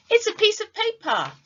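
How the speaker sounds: demo answer. Shown as a demonstration. Teacher shows the paper and models the reply. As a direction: say it brightly for the class. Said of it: "I would say".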